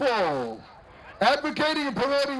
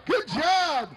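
parade announcer; 5 semitones higher than usual. Acted. Parade emcee to school float, booming announcer. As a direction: call it out to the whole street.

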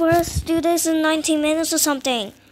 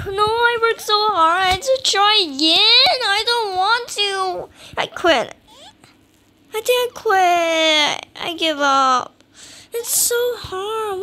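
loud wail of defeat; tearful-sounding gamer tantrum; whiny despair; 3 semitones higher, 4 dB louder than usual. Real, not acted. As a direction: whine like you are giving up on the game. Upset at losing the game.

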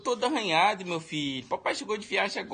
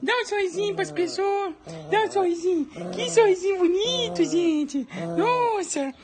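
put-on high, fond baby talk, louder at the end; baby talk to pet; 5 dB louder than usual. Dad coaxing a smile from his pet, high baby talk.

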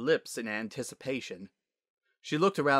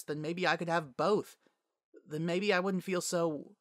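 put-on higher, earnest young voice; confiding Sunset; 7 semitones higher than usual. Sunset opens up to Twilight on the couch, confiding. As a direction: admit a long-held hurt, pitched up.

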